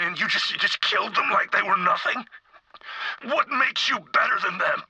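building anguished accusation; distressed outrage. Acted. Horrified confronting the doctor.

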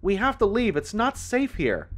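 high, urgent shouted warning; yelled. A narrator's reading. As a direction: call out loudly to someone far away. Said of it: "I yelled".

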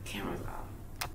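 hushed whisper. Acted. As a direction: whisper it like a secret. Joking aside, secret confession.

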